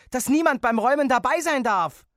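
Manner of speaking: put-on high, loud outraged child; indignant. A narrator's reading. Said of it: "sagte ich entrüstet".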